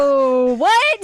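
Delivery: real-feeling shock rising; stunned. Retold or imitated. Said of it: "And I'm like".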